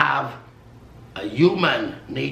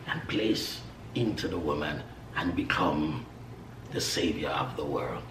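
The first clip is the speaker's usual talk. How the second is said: awed whisper; 9 semitones lower, 7 dB quieter than usual. Acted. Awed telling of incarnation.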